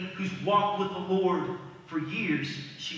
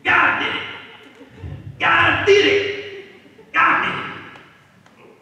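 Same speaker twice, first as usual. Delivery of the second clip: shouted high, stretched and slow; hollering praise; 12 semitones higher, 11 dB louder, slower than usual. Acted. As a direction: cry it out in triumph, drawing each word. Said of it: "she looked at him".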